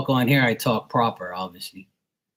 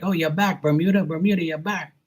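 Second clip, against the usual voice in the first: high-pitched mock Boston accent; 7 semitones higher than usual. Bostonians welcoming back their Bermudian visitor, mock Boston accent. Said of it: "So they talk all crazy".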